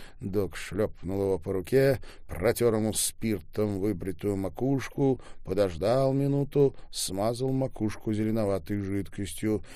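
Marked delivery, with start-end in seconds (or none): none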